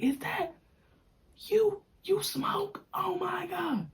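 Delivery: high whispered gasp of disbelief; mock shock. Teasing him caught hotboxing, mock gasp. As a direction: gasp it, scandalized.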